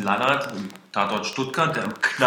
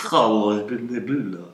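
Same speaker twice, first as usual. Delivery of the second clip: put-on Russian accent in German; 4 dB louder than usual. Trying out Russian accent again.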